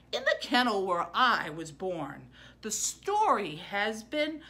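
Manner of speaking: put-on gentle read-aloud voice for kids; story reading.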